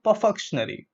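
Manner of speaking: "perfunctory" as per-FUK-shun-ary; mispronunciation. Shown as a demonstration. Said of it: "No, let's look at the syllable breakup".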